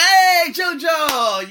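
high, loud, drawn-out hello; hollered greeting. Retold or imitated. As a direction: shout his name across the table. Acts loud greeting to JoJo.

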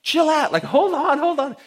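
high, loud plea to calm down; urgent calling out. Retold or imitated. Telling the panicked concierge to stop, urgent high shout. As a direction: raise the voice sharply to stop someone. Retold intervention with frantic concierge.

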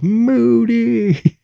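very high, loud, long-held shout; imitated crowd yell. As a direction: holler it back like fifty inmates. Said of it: "fifty inmates jumped to their feet and yelled".

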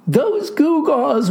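slow, high, drawn-out wail; theatrical lament, stretched out. A narrator's reading. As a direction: wail it in bitter regret. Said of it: "wailed he".

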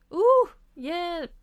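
high, loud cry for help; alarmed shout. A narrator's reading. Miffy chased by a bird shouts to Bob, alarmed. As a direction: yelp and call out in fright. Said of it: "råbte Miffy".

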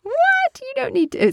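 high shocked squeak. Real, not acted. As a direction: gasp it out in disbelief. Shocked by spa dating claim.

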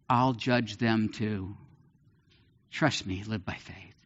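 slow, soft reassurance; calm him, trust me; reassuring. Retold or imitated. God's reassuring words voiced.

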